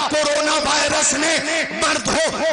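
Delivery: rising, slow shouted climax; preaching shout. Real, not acted. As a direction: build to a roaring finish. (پرجوش بلند خطاب)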